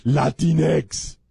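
slow, exaggerated Spanish accent on the word; mock AOC accent. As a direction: overdo the Spanish accent, drawn out. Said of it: "It's the only time A O C has an accent".

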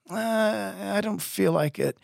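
mock reluctant groan; bummed. Don turning down the softball game, mock-bummed reluctance. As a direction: beg off like a mopey friend. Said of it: "he'd get bummed".

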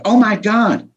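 imitating the wife's offhand reaction to a post; mild surprise. Husband imitates wife reading Facebook, light casual surprise. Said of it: "she can see somebody go".

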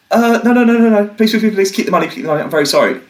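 high, pleading, rushed please-don't-hurt-me voice; frightened high plea to gunmen; panicked begging. Retold or imitated. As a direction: beg for your life, high and frantic. Begging police holding gun.

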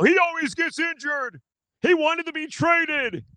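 high-pitched mock yelling listener; whiny yelling fan voice. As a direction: put on a high, silly voice for an angry fan. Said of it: "why I use that voice".

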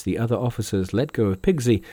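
plain reading voice

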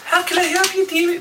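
very high squeaky hag voice. Shown as a demonstration. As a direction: pitch way up like an old hag. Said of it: "I'm gonna try voice".

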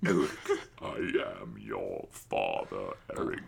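very deep, slow, hushed voice; low, ponderous mock-villain voice; dark villain impression. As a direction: drop far down and intone it slowly. Darth Vader line as voice demo.

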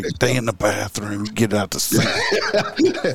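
mock stern order. Telling the coworker where to go, slow deadpan mock order. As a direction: play it as a sly joking put-down. Joking reply to the fountain prank.

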